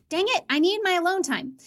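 a hammed-up frustrated complaint; mock annoyed outburst. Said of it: "She wasn't angry".

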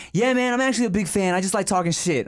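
high, fast goofy voice of the fan; imitating the hater. Telling a co-host how the hater sounded, in a high fast bro voice. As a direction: play the hater as a chirpy bro. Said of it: "He's like".

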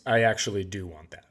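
ordinary talk, nothing marked

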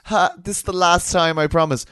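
high, loud, mocking woman's voice; mocking the passenger. Mimicking the woman on the plane, high mocking voice. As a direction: mimic her sing-song excuse. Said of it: "She's like".

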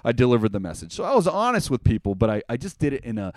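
ordinary delivery

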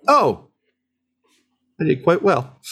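high, eager little voice; light up at the praise, high and bright; delighted squire. Acted. GM as Nibs, thrilled to hear praise from Ita.

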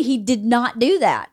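ordinary delivery

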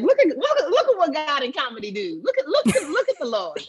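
high-pitched, laughing exclamation; amazed praise. Retold or imitated. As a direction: marvel aloud in a high, giddy voice.

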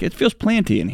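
playful quote stressing "planty"; joke mispronunciation. Acted. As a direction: lift the voice on a joking "planty". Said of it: "That's what you say when you walk into".

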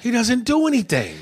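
high-pitched, exasperated agreement; emphatic echo. Real, not acted. Echoes co-host in exaggerated agreement.

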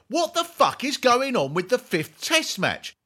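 high, loud exasperated question; mock outraged shout. Reading listener Eric's cricket question, mock outraged shout. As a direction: blurt it like the jingle, exasperated. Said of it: "Eric Heath asks".

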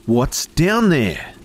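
high, slow, loud demand. A narrator's reading. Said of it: "Her voice was getting louder".

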